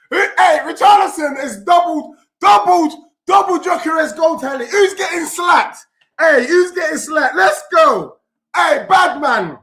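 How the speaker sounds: loud, high, laughing victory shouts; celebrating. Real, not acted. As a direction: crow over the rival fans. Celebrating a second goal.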